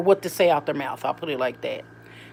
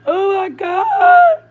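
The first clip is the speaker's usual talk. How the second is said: high, drawn-out mock wail; mock crying; 13 semitones higher, 6 dB louder, much slower than usual. Retelling a pranked mother's reaction, mock crying. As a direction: imitate a woman wailing in distress. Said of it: "she started crying and she's like".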